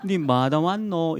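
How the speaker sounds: slow Gyeongsang dialect question, as God. Retold or imitated. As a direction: ask it slowly in Gyeongsang dialect. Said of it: "경상도 말로". (느린 경상도 사투리로 묻는 하나님)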